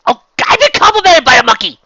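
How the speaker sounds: very high, loud Scots howl; outraged yell. Acted. Villain remembered only by Monkey Fist, outraged yell. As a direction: wail it at the top of your voice.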